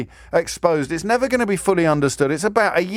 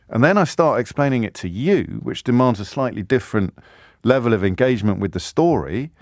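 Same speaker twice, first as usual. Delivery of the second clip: ordinary talk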